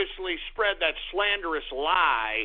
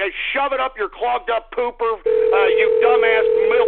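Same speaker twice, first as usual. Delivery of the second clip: raised, irate outburst; angry insult; 4 semitones higher, 8 dB louder than usual. Real, not acted. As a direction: hurl the insult at the caller. Insults caller over voicemail.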